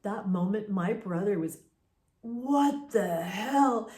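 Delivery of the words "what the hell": slow, louder, higher shock; startled exclamation. Retold or imitated. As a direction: blurt it as you bolt upright. Brother startled by the breathing.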